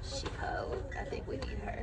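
hushed whispered aside. Real, not acted. Vlogger whispers to viewers while smelling the candle, hushed whisper. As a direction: drop to a breathless whisper, as if sharing a secret.

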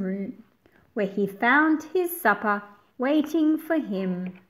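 put-on expressive read-aloud for young listeners; storybook narration. A narrator's reading. Teacher reading the picture book aloud to her class, expressive narration. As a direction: paint the pages with a lively storytelling voice.

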